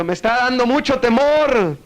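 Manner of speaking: high-pitched acted cry; mock frightened cry. Man scared by echoes calls out, mock shout. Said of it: "Y luego dice".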